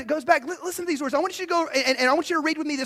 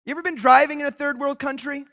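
ordinary delivery

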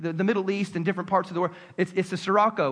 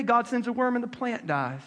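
ordinary talk, nothing marked

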